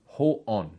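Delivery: "ho'on" said slowly, in two parts, ho-on; pronunciation of "ho'on". Shown as a demonstration. Teacher shows the Hebrew word for "I am", slow careful "ho'on". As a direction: sound out "ho'on" as HO-ON, unhurried. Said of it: "it's pronounced".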